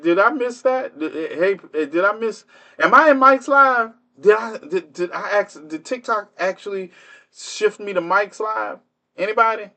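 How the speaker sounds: high, stammering mock bewilderment; feigned confusion. Streamer asks viewers whose live this is, feigned confusion. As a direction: act baffled, stumbling over high-pitched questions.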